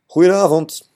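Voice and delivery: loud, high call of "Goedenavond". Acted. Boy calls to the farmer's family through the door, loud greeting. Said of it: "riep hij door de reet".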